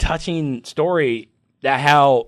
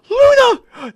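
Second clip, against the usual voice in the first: gasp, then cry her name very high; overjoyed gasp; 6 dB louder than usual. Retold or imitated. Reliving reading the reunion page, gasp and very high cry. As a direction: relive the shock of seeing her.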